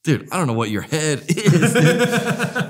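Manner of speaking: high voice breaking into laughter; retold disbelief with a laugh; amused bafflement. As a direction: imitate a baffled girlfriend, laughing through it. Retelling girlfriend's baffled reply.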